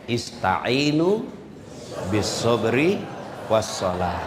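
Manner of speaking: slow, drawn-out Arabic recitation; Quran recitation. Acted. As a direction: recite each word slowly and reverently. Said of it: "Al-Quran bilang". (bacaan ayat yang pelan dan khidmat)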